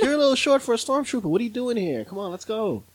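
high, quick-fire sass; sassy Princess Leia. Acted. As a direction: imitate an ornery heroine hurrying her rescuer.

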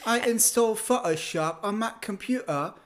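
caricatured British English; mock British accent. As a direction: exaggerate a stop-start British accent. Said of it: "there's this slowness in how they speak".

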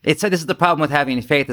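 normal talking voice

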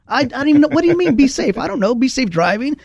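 a friend from Boston can't believe what he hears. Retold or imitated. Boston friend baffled, voice pitched high. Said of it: "He said".

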